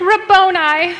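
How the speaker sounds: high, loud joyful cry of recognition; cried out. Acted. Mary crying out to Jesus on recognizing him. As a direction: call out his name as it dawns on you. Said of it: "cried out in Aramaic".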